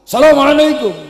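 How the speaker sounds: high, loud salam. Shown as a demonstration. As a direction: call the greeting out high and hard. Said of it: "dengan nada tinggi, suara keras".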